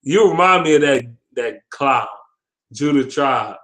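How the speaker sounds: ordinary talk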